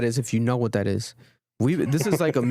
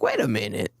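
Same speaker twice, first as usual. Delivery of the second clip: put-on thick African accent; 12 semitones higher, slower than usual. Fan recognizing him at the mall, amazed, thick African accent. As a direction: imitate an accented fan's dawning recognition. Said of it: "he has the accent".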